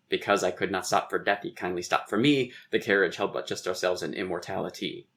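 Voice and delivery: "Because I could not stop for death" in even weak-strong iambs; singsong scansion, every second syllable stressed from "Because" to "immortality"; emphasized meter. Shown as a demonstration. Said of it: "if you want to emphasize the meter".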